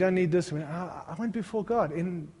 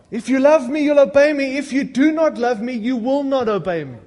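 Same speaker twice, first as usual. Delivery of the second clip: loud, ringing proclamation of the verse; preached scripture; 6 semitones higher, 7 dB louder than usual. Retold or imitated. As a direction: hammer the verse out to the church.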